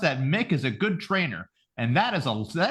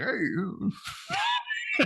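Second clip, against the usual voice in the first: startled shout; 4 dB quieter than usual. Retold or imitated. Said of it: "and you're like".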